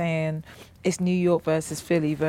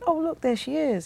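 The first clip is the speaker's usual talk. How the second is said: high, lilting imagined exclamation; higher-pitched quoted voice; acted discovery quote; 8 semitones higher than usual. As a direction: play someone spotting a newcomer, pitched up. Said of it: "him coming and saying".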